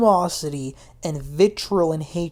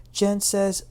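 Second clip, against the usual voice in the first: ordinary delivery, nothing marked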